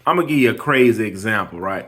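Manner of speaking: ordinary talk, nothing marked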